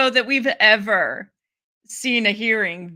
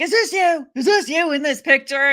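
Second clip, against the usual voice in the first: higher, louder mock interrogator; mock questioning; 4 semitones higher, 5 dB louder than usual. Host re-enacting a witness questioned over a photo, mock questioning. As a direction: press the question, pitched up in imitation.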